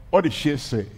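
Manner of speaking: ordinary talk, nothing marked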